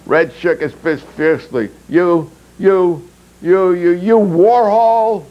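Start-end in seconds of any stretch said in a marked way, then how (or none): 1.9-5.2 higher, louder, slowing to a drawn out Warhol; furious sputtering. Acted. Red shaking his fist up at Anatol, furious. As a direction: choke on rage then spit the insult.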